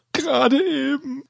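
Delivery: a hint of tearful sobbing. A narrator's reading. Desperate student sobbing on the phone, mild sob. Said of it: "sagt er schluchzend".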